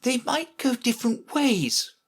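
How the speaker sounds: high-voiced, mildly solemn; grave. A narrator's reading. Alice answering the Queen's logic, grave. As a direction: offer it with careful seriousness, high voice. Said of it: "as gravely as she could".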